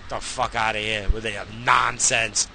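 ordinary talk

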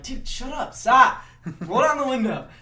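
mock the girls telling him off; imitated girls scolding. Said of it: "the girls in the front seats are like".

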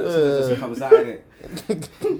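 high, loud cockney-ish innit; British accent. Pretend. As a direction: slip into a London accent. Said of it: "Please stick to one accent".

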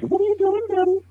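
put-on kid voice. Dad imitating his kids.

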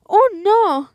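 high, loud "oh, no"; shouted cry. A narrator's reading. Tortilla refuses the hungry rooster, crying out. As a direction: cry it out in alarm. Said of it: "gritó la tortilla".

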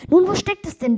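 put-on high, eager question to the old man; eager asking. Young bride coaxing the old man, high and eager. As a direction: lift the voice high and coax.

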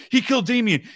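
high-pitched, loud tattling; frantic mock ratting out. Two pals instantly ratting each other out, in high mock panic. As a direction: blurt the accusation high and loud. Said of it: "them both ratting each other".

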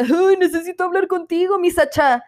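high, whiny plea to her friend; needy friend. Retold or imitated. Woman rushes to her best friend, high pleading voice. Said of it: "así como de".

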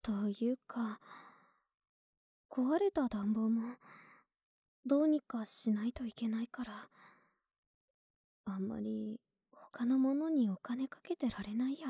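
hushed, breathy bedtime whisper close to the ear; soft whisper. Acted. Girlfriend whispering her worries to her partner in bed.

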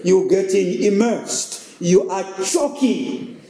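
a pastor preaching at full stride, rising and falling. Acted. Pastor preaching to the congregation, earnest. Preaching a sermon on Psalm 121.

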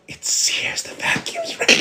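soft, voiceless whisper; retold panicked whisper. Caught prankster to his mother, panicked whisper.

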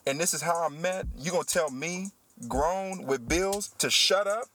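hot, indignant disbelief. Real, not acted. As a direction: let the outrage climb to a peak. Indignant rant at the rude lady.

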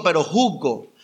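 ordinary talk

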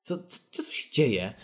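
slow, stunned mock disbelief; lightly hammed-up shock; baffled, drawn-out question; mock alarm. Feigned shock at scene.

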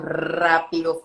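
ordinary talk, nothing marked

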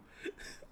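long, wheezing, breathless laugh; real uncontrollable laughing fit; helpless laughter. Real, not acted. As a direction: crack up until no voice is left. Cracks up at own story.

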